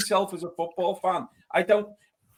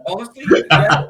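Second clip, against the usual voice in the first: laughing out loud while admitting it; 10 dB louder than usual. Real, not acted. Guest admits a wedding punch-up, laughing loudly. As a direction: laugh through the confession, louder.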